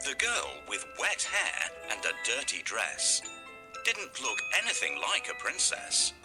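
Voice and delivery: usual speaking voice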